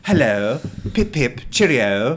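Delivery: hammed-up pip-pip British caricature; mock British accent. Host ridiculing the actress's supposedly convincing accent, mock British. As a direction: lay on a silly, overdone British accent. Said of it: "It's so convincing British".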